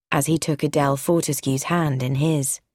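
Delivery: ordinary delivery, nothing marked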